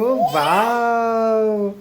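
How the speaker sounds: stretched-out wow. Real, not acted. Drawn-out praise to kids.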